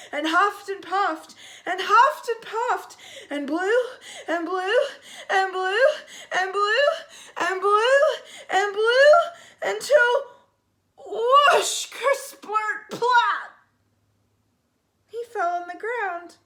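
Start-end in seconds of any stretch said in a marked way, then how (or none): 0.1-10.3 rising louder and higher with each blew; the shark blows harder and harder at the house; building strain. A narrator's reading. Shark blowing with all his air. 11.0-13.5 loud, high comic whoosh and plop; sound effects. A narrator's reading. Sound effects of shark deflating.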